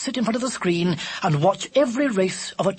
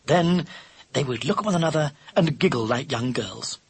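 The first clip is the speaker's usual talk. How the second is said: ordinary delivery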